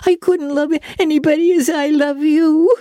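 put-on very high, wailing little-girl voice; sobbing girl. A narrator's reading. Diana swears love to her bosom friend, sobbing wail. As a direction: wail it as a tearful child. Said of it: "sobbed Diana".

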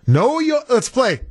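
high-pitched mock host intro; game-show host. Host launching a canal quiz, game-show host. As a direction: announce it like a quiz title.

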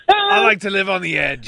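high-pitched comic British voice; Slap Slapley. Acted. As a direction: pitch it way up, like a silly Brit. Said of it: "Edgy Slap".

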